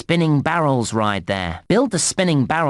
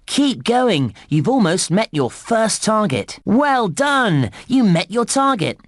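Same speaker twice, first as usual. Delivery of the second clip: higher, upbeat cheering on; excited congratulation; 7 semitones higher than usual. Acted. Game guide to player who met the target, excited congratulation. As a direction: congratulate with high-pitched delight.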